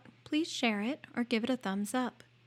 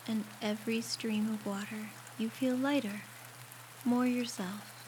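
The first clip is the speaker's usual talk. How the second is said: guided meditation; 8 dB quieter than usual. Acted. Guiding a listener through a shower meditation, calm and unhurried. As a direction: ease the listener gently through each step, leaving space to breathe.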